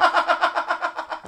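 high laugh. Real, not acted. Laughing at own numb tongue.

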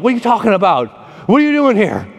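high, quick mock challenge; hammed-up incredulous questioning; indignant disbelief. Acted baffled challenge to know-it-all.

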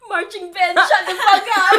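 a loud, laughing shout at the band; mock furious shout at a marching band; angry yell. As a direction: yell it over the noise, half laughing. Yelling at imagined marching band.

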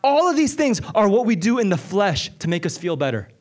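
high, driving sermon voice; pleading with the congregation, urgent preaching; impassioned preaching. Acted. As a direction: preach it with rising fire. Preaching builds to full force.

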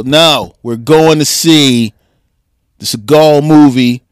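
loud, slow, stretched-out insistence; mock insistent. Retelling telling his date which movie, mock insistent. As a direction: lay down the law, drawing out each phrase.

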